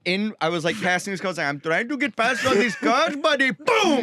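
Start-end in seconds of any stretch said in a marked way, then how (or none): 1.5-4.0 put-on high Indian accent, retelling a crash. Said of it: "Just mid Indian accent".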